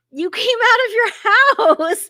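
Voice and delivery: high, loud delighted squeal; excited friends. Retold or imitated. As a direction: squeal it like thrilled friends seeing you again. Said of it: "my friends were like".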